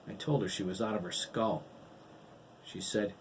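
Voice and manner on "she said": ordinary delivery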